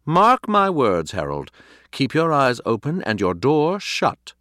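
slow, high, loud warning; dramatic, drawn-out prophecy of doom; ominous conviction. A narrator's reading. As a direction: pronounce a dire warning with conviction. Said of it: "something in the conviction with which he spoke".

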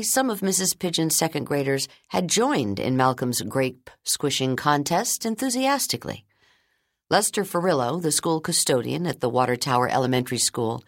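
usual speaking voice

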